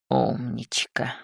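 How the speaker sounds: low, soft and slow mutter. Acted. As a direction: mutter the praise under breath. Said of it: "пробормотал Раум".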